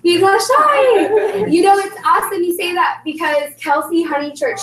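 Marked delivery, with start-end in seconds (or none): 0.0-1.5 laughing. Real, not acted. Echoes guest's answer, laughing.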